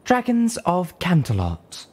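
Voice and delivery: high, loud, slow majestic address; grand magnified boom. A narrator's reading. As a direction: proclaim it to the dragons. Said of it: "Her voice boomed, magnified majestically".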